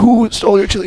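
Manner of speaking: high, loud imitation of a demanding boss; agitated boss. Boss questioning the worker about theft, high loud mock urgency.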